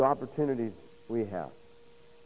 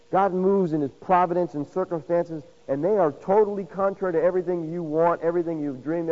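ordinary talk

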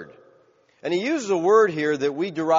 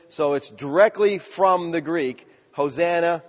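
normal talking voice

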